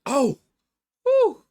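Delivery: high, loud relieved whoop; huge relief. Real, not acted. Gamer surviving the fight, high loud relief. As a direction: let it all out, you survived.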